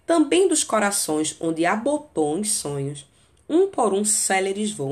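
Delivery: reciting the sonnet to the class; poem reading. Acted. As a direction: recite the line flowing, at normal pace. Reads the tercet aloud.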